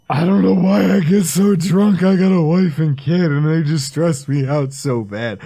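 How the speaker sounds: a hint of a blubbering drunk pouring his heart out; imitating a crying drunk friend in a pizzeria; mock drunk crying. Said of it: "He's crying in the pizzeria going".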